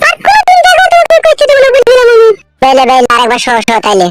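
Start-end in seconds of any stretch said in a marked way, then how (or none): 0.1-2.3 high falsetto woman's voice; young woman voice. Acted. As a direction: squeak it up as the girlfriend. Girlfriend voice.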